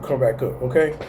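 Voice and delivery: ordinary talk, nothing marked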